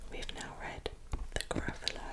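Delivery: plain reading voice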